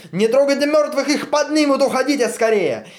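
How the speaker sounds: very high, loud urgent order; Stalin ordering Teofil to flee, loud shout. Acted. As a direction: yell them away from the dead. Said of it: "кричит Теофилу".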